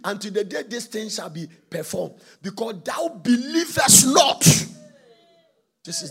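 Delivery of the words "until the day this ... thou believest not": angel's curse. Acted. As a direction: pronounce judgment on him. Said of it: "He came and said".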